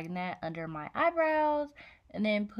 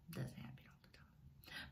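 whisper; 19 dB quieter than usual. Real, not acted. Trailing whispered admission.

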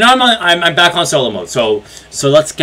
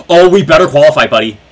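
louder, fast, anxious plea; gasping loud plea; 7 dB louder, faster than usual. Real, not acted. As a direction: gasp and blurt it out. Gasp then loud fast plea.

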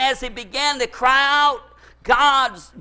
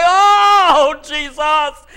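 a loud, high, joyful "Oh, Jesus"; joyful shout; 5 semitones higher, 7 dB louder, much slower than usual. Retold or imitated. Riding hands-free, he shouts his joy to Jesus.

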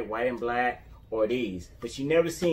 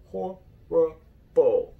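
slow, stretched horrible; drawn-out disgust; much slower than usual. Real, not acted. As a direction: drag the word out in disgust. Repeats verdict on leather.

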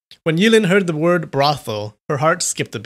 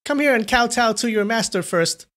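lightly, higher, carefree; airy; 9 semitones higher than usual. A narrator's reading. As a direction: toss it off airily. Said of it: "said airily".

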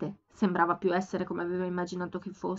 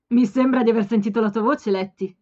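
flirty; 5 dB louder than usual. A narrator's reading. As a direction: call out with a teasing grin. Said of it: "con tutta l'aria di voler flirtare".

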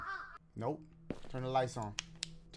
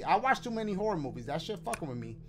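ordinary delivery

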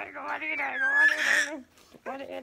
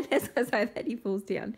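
ordinary delivery

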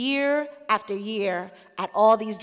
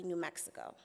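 ordinary talk

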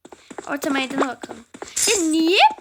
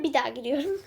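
ordinary talk

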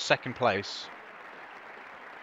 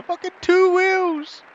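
loud, very high triumphant cheering; over-the-top stunt hype; high whooping excitement; 5 dB louder, much slower than usual. Pretend. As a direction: cheer it on in a squealed shout. Cheering own trick in game.

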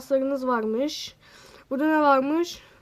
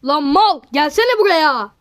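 ordinary delivery

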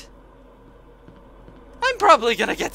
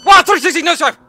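excited; 9 semitones higher, 12 dB louder, faster than usual. Real, not acted. Enemy spins past him.

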